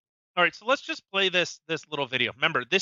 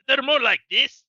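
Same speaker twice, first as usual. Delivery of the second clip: put-on higher, tentative stab at a Scottish accent; attempted Scottish accent; 6 semitones higher, 5 dB louder than usual. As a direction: fumble towards a Scottish accent. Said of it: "Scottish accent".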